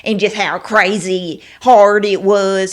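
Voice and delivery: imitated Southern country drawl; country boy accent. Recalling a classmate talking about homework, imitated country accent. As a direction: slip into a country boy's Texas twang. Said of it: "one of the very country boys talking".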